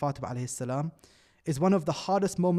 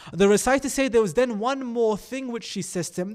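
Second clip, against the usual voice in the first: usual speaking voice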